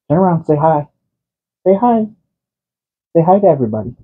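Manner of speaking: high, coaxing voice for the cat; pet talk. Real, not acted. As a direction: coax the cat sweetly. Dad coaxing his cat on camera.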